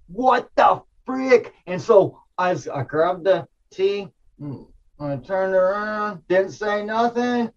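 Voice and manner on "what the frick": stunned. Retold or imitated. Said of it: "fear of scared, but, like".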